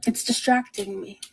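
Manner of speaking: ordinary talk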